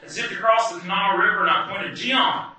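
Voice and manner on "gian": calling out. Retold or imitated. Panicked warning to driver.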